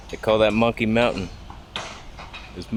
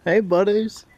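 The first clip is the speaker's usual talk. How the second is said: much higher, coaxing the monkeys; nervous high voice; 9 semitones higher than usual. Real, not acted. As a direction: sweet-talk the monkeys while scared. Talking to approaching monkeys.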